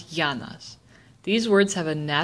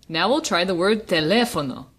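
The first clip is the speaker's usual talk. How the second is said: normal talking voice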